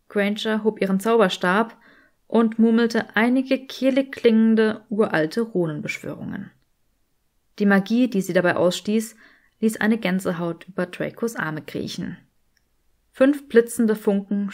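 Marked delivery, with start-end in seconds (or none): none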